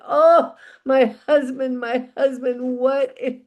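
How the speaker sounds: high, loud mock wail of a grieving wife; hammed-up tearful wailing; wailing and weeping. A narrator's reading. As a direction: lament dramatically, drawing out the cries. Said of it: "and she's crying".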